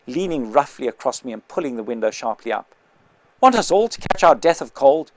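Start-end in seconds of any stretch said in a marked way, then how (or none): 3.4-5.1 angry scolding. Acted. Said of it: "shouted the intelligent man".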